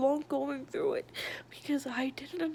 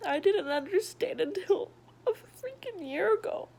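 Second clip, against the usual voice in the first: higher, louder voice breaking into tears; crying; 6 semitones higher, 4 dB louder than usual. Real, not acted. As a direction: let the sob break through. Breaks down recounting her past.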